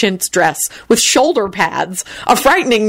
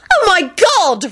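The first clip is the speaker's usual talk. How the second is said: high-pitched scream at moderate volume; horrified high cry; 12 semitones higher than usual. A narrator's reading. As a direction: shriek it in outrage, reined in. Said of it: "Rosie screamed".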